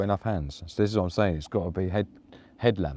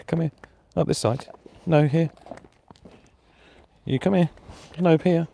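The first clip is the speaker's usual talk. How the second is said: louder, higher firm call; raised, higher command to the dog; 7 semitones higher, 3 dB louder than usual. Real, not acted. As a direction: summon the dog to a spot. Calling dog into shot.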